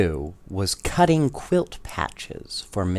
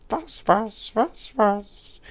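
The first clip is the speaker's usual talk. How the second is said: high, odd sleepy parrot mutter; cranky parrot complaining asleep; grumbling parrot; 12 semitones higher, slower than usual. A narrator's reading. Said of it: "grumbled in his sleep".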